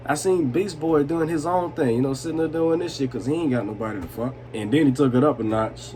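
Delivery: ordinary delivery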